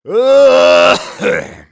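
very loud, high hacking fit; old man's cough. Acted. Old husband interrupts himself before his wife, violent cough. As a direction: burst into a long acted coughing fit.

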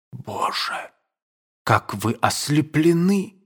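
breathless, horrified exclamation. A narrator's reading. Aduev to Nadenka, horrified she is blinded by the count. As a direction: gasp it out in dismay. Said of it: "с ужасом воскликнул Александр".

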